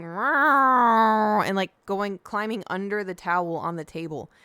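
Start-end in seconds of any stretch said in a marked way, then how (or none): 0.0-1.4 long, loud cat "rawr"; drawn-out mock cat growl; imitated cat yowl. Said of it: "He was going".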